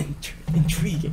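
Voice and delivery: hushed nature-documentary narrator; softly intrigued, as if commenting on a discovery; understated, quiet documentary commentary; future David Attenborough. Acted. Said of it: "the future David Attenborough is gonna be commenting".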